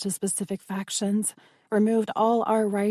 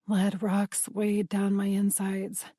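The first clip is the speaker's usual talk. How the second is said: ordinary delivery, nothing marked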